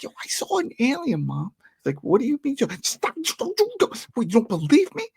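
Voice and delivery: high-pitched, hammed-up kid outburst; upset child. Host shows how the child actor should plead with Mom, worked up. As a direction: play a distraught kid pleading to be believed.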